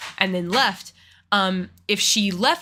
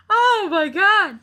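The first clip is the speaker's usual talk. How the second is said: stunned disbelief; 12 semitones higher, 5 dB louder, slower than usual. Real, not acted. Reacting to the teacher's attitude.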